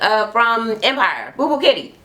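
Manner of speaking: usual speaking voice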